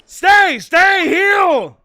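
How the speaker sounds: loud, high tamer's order; shouted animal command. Acted. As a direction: call a wild animal to heel. Voices tamer commanding the tiger.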